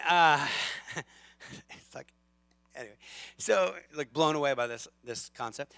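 usual speaking voice

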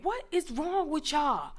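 high, slow mock exasperation; imitating exasperated man. Man rebuking passengers after a boy refuses, slow mock exasperation. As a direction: draw it out high in disbelief. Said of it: "he was like".

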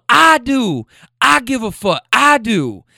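high, loud insistence as the woman; imitated yelling. Woman insisting to her man she cares, imitated yelling. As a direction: holler it back in a mock-woman voice. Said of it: "now they're screaming".

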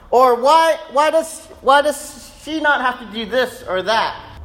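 high, loud whining protest; whiny kid. Retold or imitated. Pastor imitating son's complaint, whiny high voice. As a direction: play the outraged little boy, half laughing. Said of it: "he'll say something like".

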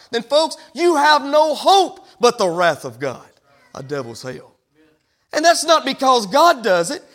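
hammering each attribute of God; preaching. Acted. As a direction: build the point from the pulpit. Preaching climax on judgment.

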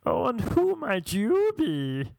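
high, drawn-out mock-posh greeting; theatrical English-sounding creep. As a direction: stretch the vowels in an exaggerated creepy put-on voice. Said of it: "that's how he was talking".

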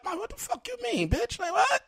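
squeaky, stunned imitation of his own reaction; shocked disbelief. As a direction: replay the moment the news hit you. Retelling hearing [name] died.

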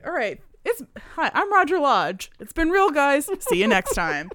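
loud, high game-show host sign-off. Retold or imitated. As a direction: imitate Roger Lodge hosting a TV show. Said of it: "like, a host voice".

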